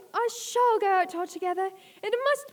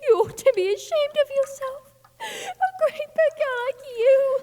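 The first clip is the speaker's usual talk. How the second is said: tearful scolding; 6 semitones higher, 4 dB louder than usual. Acted. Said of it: "began to cry again".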